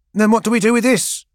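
put-on high, pressing young woman's question; young woman asking. Gang guide asking Keeler about the wagons, high and pressing. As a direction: ask it as the worried young guide.

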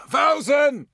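high desperate battlefield scream. Acted. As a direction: scream the name across the battle. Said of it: "someone was screaming".

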